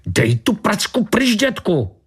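very high-pitched, angry shriek; angry outburst. A narrator's reading. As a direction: lash out in a high character voice. Suspect snaps at officer holding her. (rozzlobené ječení vysokým hlasem)

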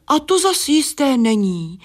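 ordinary delivery

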